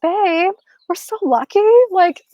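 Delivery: high-pitched tender gush. Retold or imitated. Speaker tells Barry how lucky they are, gushing. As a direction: coo it high, like a thrilled partner.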